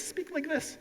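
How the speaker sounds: high, soft tiny voice. Shown as a demonstration. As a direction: make the speech sound small. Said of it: "think like this and speak like".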